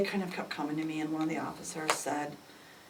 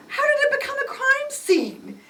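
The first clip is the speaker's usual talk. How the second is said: high, loud, half-laughing half-crying; a strangled cry of disbelief; shocked disbelief; 7 dB louder than usual. Retold or imitated. Mother to officer, shocked and near tears.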